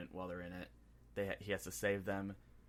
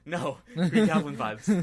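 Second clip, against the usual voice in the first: much louder, slow and emphatic; loud, slow fan excitement; excited outburst; 7 semitones higher, 16 dB louder, slower than usual. Real, not acted. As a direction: blurt it out thrilled. Excited fan realization, acted.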